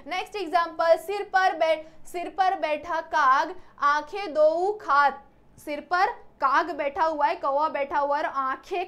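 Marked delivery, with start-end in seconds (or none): 1.0-5.3 slow verse recital, slightly louder; slow verse reading. Shown as a demonstration. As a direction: spell out the verse slowly. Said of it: "recites verse slowly".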